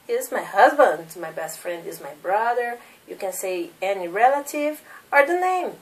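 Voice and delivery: ordinary talk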